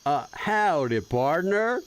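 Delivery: very slow, stretched Texas drawl; bad fake Texas accent. Streamer trying out a fake Texas accent, drawn-out. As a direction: stretch out a fake Texan twang. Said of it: "gonna do the bad fake Texas accent".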